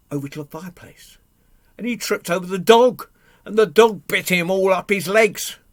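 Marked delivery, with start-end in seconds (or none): 1.8-5.6 loud, raised climax; dramatic, high-energy narration; excited storytelling. A narrator's reading. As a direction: punch out the mishap at a higher pitch. Climax of the chase told.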